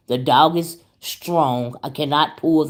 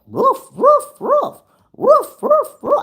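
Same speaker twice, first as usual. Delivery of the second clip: high yappy imitated bark; dog barking; 4 dB louder, slower than usual. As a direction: imitate the dog's serious barking, much higher. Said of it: "I keep hearing the dog barking".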